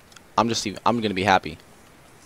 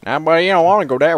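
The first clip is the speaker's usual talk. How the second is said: put-on southern cowboy accent, quick and louder; mock southern cowboy; 8 semitones higher, 8 dB louder, faster than usual. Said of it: "There's a cowboy in everybody".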